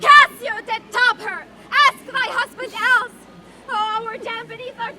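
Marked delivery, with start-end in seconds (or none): 0.0-3.1 loud, raging accusation; furious shout. Acted. Husband roaring his proof at the maid, furious. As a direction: hurl it at her at full force. Othello rages at Emilia.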